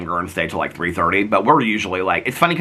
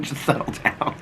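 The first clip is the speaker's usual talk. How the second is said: breathy hushed aside, much softer; 5 semitones higher, 5 dB quieter, slower than usual. Retold or imitated. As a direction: shush him under your breath. Jesse hushes Fufu at brunch.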